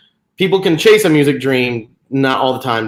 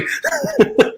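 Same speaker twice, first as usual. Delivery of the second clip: embarrassed laugh. Real, not acted. Embarrassed after the accent attempt.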